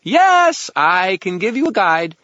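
very high, loud, sung exclamation; singsong fairy. A narrator's reading. Fairy remembers a guide, triumphant singsong. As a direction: sing it out in triumph. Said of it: "he sang".

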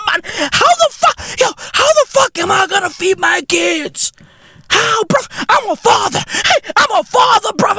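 high-pitched hysterical mock wailing; freaking out. Comedian plays a father unable to feed his kids, freaking out. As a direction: play a desperate breadwinner, voice way up high.

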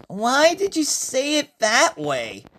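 high-pitched puzzled question; confused. Acted. Usuke questioning Iridis's wording, confused. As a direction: ask it high, baffled. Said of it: "Usuke confused".

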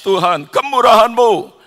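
imitating an overwhelmed prayer; retold tearful prayer. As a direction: re-enact a heartfelt plea, high and strong. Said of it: "Saya nangis".

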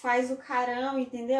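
normal talking voice

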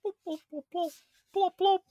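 very high silly popping noises; high squeaky blops. Pretend. Banana fighter sound effect.

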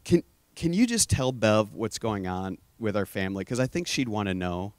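low, soft, halting plea; retold tearful prayer. Begging God to tell his sister-in-law, softly tearful. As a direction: softly relive a crushed, pleading prayer. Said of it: "I'm just crying".

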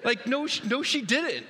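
high, incredulous and half-laughing; amused disbelief. Real, not acted. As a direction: marvel at the little liar. Amused disbelief at toddler's lie.

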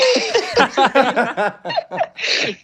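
high, far-off burst of laughter; high laughing burst; big laugh. Real, not acted. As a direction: laugh out hard at the punchline. Laughing at own story punchline.

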